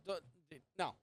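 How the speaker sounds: normal talking voice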